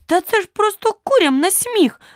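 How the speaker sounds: very high-pitched, indignant outburst; exasperated exclamation. A narrator's reading. Alice's patience snaps at the knight, crying out exasperated. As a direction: cry out, all patience gone. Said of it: "вигукнула вона".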